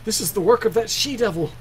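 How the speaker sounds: high, swooping servant's voice; alarmed servant. Acted. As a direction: insist in high-pitched alarm. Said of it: "I'll speak for the servants".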